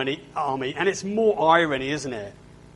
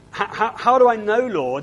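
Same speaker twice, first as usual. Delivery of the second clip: pleading Moses; 6 semitones higher, 6 dB louder than usual. Acted. Moses voiced pleading with God.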